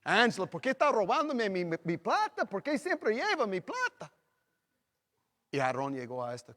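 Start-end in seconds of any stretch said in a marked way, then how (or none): 0.0-4.1 complaining husband. Retold or imitated. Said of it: "yo siempre dije".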